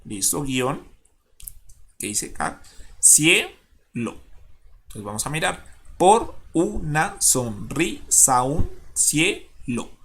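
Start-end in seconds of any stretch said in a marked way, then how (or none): 3.0-4.2 "cielo" broken as cie, pause, lo; split into syllables. Shown as a demonstration. Teacher dividing the last word, "cielo" syllable by syllable. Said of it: "la manera más adecuada de dividirla". 6.0-9.9 "por una sonrisa, un cielo" slowly split, with sa-un joined; syllable by syllable. Shown as a demonstration. Teacher dividing verse poetically, "por una sonrisa, un cielo" in slow syllables. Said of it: "la manera más adecuada de dividirla poéticamente".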